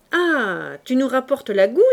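loud exclamation. Acted. Sergeant greeting Fabrice's brandy, loud hearty call. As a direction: cry out gladly at the bottle. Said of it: "s'écria le maréchal des logis".

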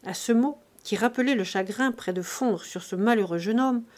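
usual speaking voice

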